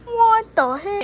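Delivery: sudden loud startled what the heck; surprised. Real, not acted. Surprise during alarm test.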